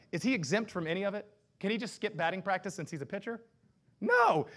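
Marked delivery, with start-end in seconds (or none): 4.0-4.5 emphatic no. Acted. Emphatic answer in sermon.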